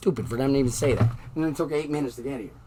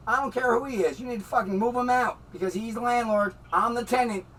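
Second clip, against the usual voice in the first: angry yelling; 7 semitones higher, 4 dB louder than usual. Real, not acted. Yelling at the cop.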